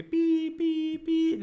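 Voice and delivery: high drawn-out whistle blasts voiced as "pee"; high-pitched mock whistle; imitated whistle. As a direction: mimic a guard's whistle, long even notes. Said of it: "सीटी बजाते थे जोर से".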